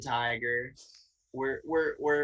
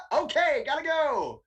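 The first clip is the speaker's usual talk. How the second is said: higher, louder rushed bail-out; panicked exit; 10 semitones higher, 4 dB louder than usual. Retold or imitated. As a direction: blurt it out, desperate to escape. Panicked escape from gas station.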